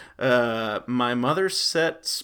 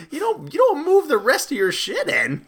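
luid en verontwaardigd; 11 semitones higher, 6 dB louder than usual. Acted. Acted exasperated mover.

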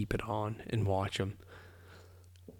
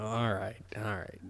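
resigned sigh; 7 dB quieter than usual. Retold or imitated. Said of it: "she just, like".

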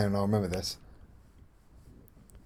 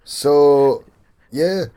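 louder Yorkshire farmer, slow sign-off; 2 semitones higher, 7 dB louder, slower than usual. Pretend. Mate closes the pitch as a Yorkshire farmer. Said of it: "like a Yorkshire raspy farmer".

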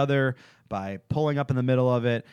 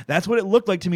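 normal talking voice

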